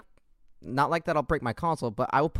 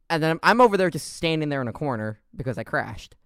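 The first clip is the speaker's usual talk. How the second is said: normal talking voice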